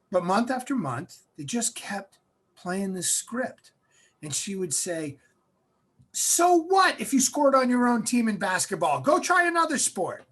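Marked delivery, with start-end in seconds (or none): 6.1-10.2 loud, high imitation of mom; pushy mother. Mother pushing her son to keep trying, loud imitation. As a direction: dismiss the failure loudly and urge him on.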